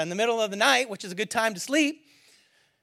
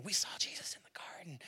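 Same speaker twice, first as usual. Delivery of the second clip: acted whisper, very soft and low; hushed report; 6 semitones lower, 18 dB quieter than usual. Women telling the hidden disciples, whispered. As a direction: whisper it like news in a hiding place.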